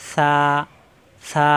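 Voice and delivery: সা with a long, held আ; drawn-out vowel. Shown as a demonstration. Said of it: "একটু টান দেওয়া যায়". (একটু টান দিয়ে সা)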